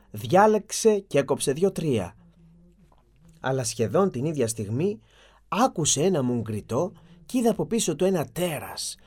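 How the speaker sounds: plain reading voice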